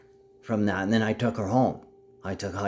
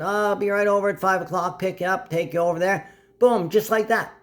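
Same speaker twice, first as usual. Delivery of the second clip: high-pitched obliging pickup offer; put-on high voice promising a lift; acted eager promise; 9 semitones higher than usual. Acting out a ride being arranged.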